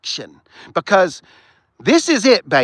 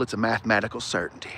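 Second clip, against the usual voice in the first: usual speaking voice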